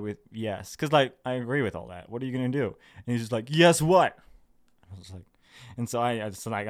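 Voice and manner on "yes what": loud, raised challenge; mock demanding retort. Commenter demanding more after a bare yes, mock demanding. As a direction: imitate an impatient commenter, hitting it loud.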